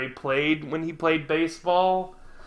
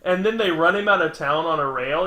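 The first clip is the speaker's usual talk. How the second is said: usual speaking voice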